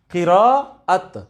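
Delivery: قراءت repeated very slowly as qi-ra-at; drilled qira'at. Shown as a demonstration. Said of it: "کیا ہوتا ہے؟".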